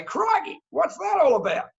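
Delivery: high-pitched, astounded Aussie exclamation; astounded Aussie surprise. Acted. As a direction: act floored by the price. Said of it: "Expression of surprise".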